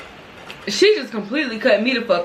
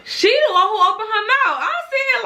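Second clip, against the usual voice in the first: getting hyped; 10 semitones higher than usual. Real, not acted. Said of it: "look at me getting hyped".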